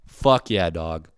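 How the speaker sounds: ordinary talk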